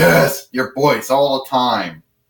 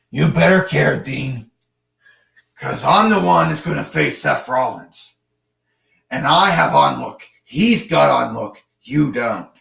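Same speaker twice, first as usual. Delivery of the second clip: strained laryngitis-style Batman growl; gravelly Batman. Acted. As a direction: growl it out like a hoarse Dark Knight. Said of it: "like you got laryngitis or whatever".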